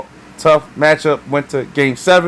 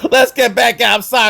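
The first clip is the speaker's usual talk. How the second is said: higher, laughing as he tries to move on; laughing through it; 9 semitones higher than usual. Real, not acted. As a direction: laugh while pulling yourself back on track. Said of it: "I got emotional for a second there".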